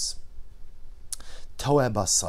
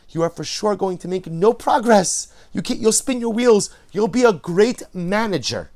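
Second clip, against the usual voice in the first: much louder and higher, fired up; preaching louder; 8 semitones higher, 8 dB louder than usual. Real, not acted. Preacher urging the congregation, loud and rising. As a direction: drive the point home with force.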